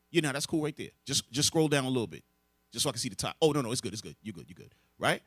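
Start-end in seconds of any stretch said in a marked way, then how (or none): none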